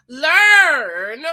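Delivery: slow, loud, drawn-out scripture line; loud, stretched "Learn of me"; quoting Jesus. Retold or imitated. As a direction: proclaim it slowly like scripture. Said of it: "even Jesus says".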